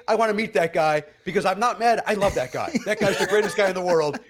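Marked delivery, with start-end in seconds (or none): none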